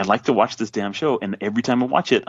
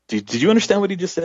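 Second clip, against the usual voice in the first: high, fast and eager; pressing his wife to get it; excited; 11 semitones higher, faster than usual. Retold or imitated. Husband eagerly asks his wife in the car, high and fast. Urging wife about the song.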